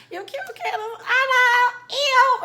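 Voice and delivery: mock the friend with a loud, high sing-song; high mocking girly voice.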